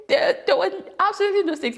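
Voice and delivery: high, quick laughing disbelief. Real, not acted. To the listener, laughing about childhood stakes. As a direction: laugh the words out in amused disbelief.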